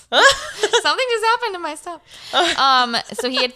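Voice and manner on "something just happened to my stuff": high, laughing through the words. Real, not acted. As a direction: laugh off a small scare. Laughing after a sudden scare.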